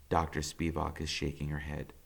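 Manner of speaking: usual speaking voice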